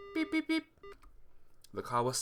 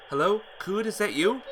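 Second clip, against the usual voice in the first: calling into phone; 7 semitones higher, 5 dB louder than usual. Acted. Shouting into bad phone line.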